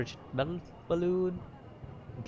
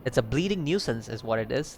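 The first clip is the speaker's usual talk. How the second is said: ordinary talk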